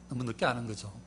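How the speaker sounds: normal talking voice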